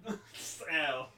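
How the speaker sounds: usual speaking voice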